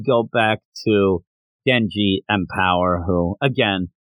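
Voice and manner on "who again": ordinary talk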